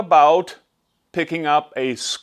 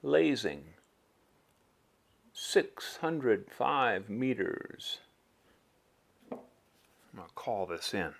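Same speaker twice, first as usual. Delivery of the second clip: low, quiet murmur behind the scope; hushed spotter murmur; 5 semitones lower, 15 dB quieter than usual. Acted. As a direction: keep it hushed, barely above breath. Sniper spotter murmuring to himself.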